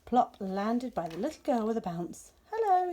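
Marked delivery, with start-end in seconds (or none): 2.5-2.9 bright eager hello. Acted. Owlet greets girl brightly.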